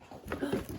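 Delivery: sharp intake of wonder; amazed gasp. Real, not acted. Teacher finding something in the kit, amazed gasp. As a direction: gasp at a discovery.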